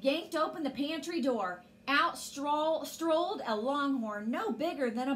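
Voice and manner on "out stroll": ordinary delivery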